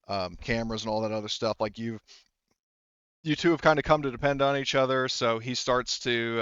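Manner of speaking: ordinary delivery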